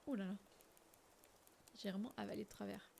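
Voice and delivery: soft, recovering from a coughing fit; hushed after choking. Real, not acted. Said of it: "j'étais en train de m'étouffer".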